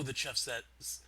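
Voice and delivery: normal talking voice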